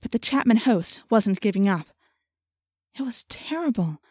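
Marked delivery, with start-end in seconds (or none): 3.0-4.0 hushed. A narrator's reading. Narrator hushed in awe.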